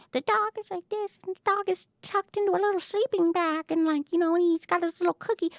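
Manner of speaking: put-on high, babyish little-dog voice; tiny puppy voice. Describing the timid dog inside her, in a high babyish voice. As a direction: coo it like a timid puppy snug in a sleeping bag.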